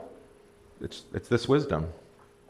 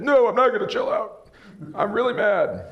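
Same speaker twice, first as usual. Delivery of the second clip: mock angry refusal; 11 semitones higher than usual. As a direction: play someone who refuses to calm down, hammed-up. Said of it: "they hate that".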